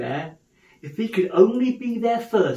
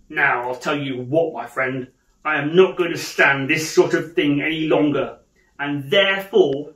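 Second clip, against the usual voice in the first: mock-stern boss; 5 dB louder than usual. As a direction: build up like a coming dismissal.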